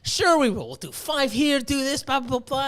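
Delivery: high, insistent retold comeback; overconfident comeback. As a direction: rattle off the plan with mock confidence. Acts out arguing back with overconfidence.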